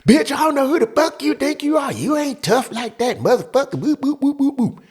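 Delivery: very high mock-screeching woman; imitated angry rant running into gibberish. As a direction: let loose in a put-on female shriek.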